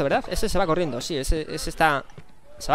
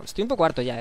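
normal talking voice

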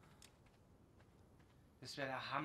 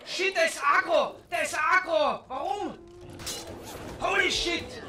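much higher, much louder alarmed yells; panicked shouting; 13 semitones higher, 17 dB louder than usual. Real, not acted. Gamer yelling as an aggro creature charges him, panicked. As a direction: scream in real panic as the beast turns on you.

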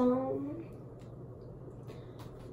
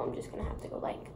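fast, softer whisper; 12 dB quieter, much faster than usual. Real, not acted. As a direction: whisper it quickly. Hushed aside on nails.

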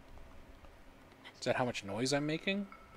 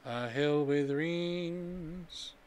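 slow musing repeat; 4 semitones higher, 3 dB louder, much slower than usual. Real, not acted. Repeating the quest clue.

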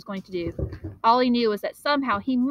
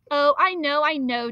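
woeful; 6 semitones higher, 5 dB louder than usual. Acted. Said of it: "John admitted woefully".

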